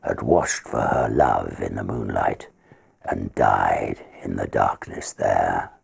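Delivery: measured, rhythmic reading of a ballad; poem recitation. A narrator's reading. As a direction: recite it like a dramatic old ballad.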